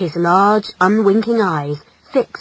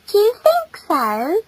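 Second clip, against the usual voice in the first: high, coy question; high-pitched girlish delight; flattered female; 12 semitones higher than usual. Acted. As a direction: ask it coyly, fishing for more.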